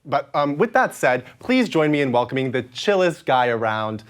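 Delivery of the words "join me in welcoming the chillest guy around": host introduction. Acted. Host announcing guest.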